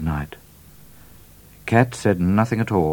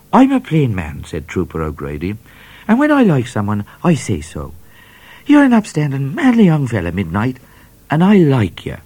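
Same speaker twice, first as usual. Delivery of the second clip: higher, louder hearty voice; hearty trooper; 7 semitones higher, 6 dB louder than usual. A narrator's reading. Trooper praising Midnight, loud and hearty. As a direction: bluff and friendly.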